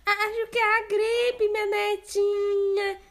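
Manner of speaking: wolf disguising voice. Acted. Wolf posing as grandma, disguised voice. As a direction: pass yourself off as grandma.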